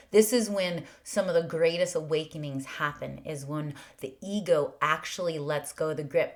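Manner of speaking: ordinary talk, nothing marked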